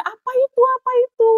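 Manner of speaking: high, loud mock shriek of fright; imitated frightened toddler. Toddler scared of own poop, high mock-frightened squeal. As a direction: squeal it like a scared little child. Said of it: "Dia takut, dia jerit-jerit".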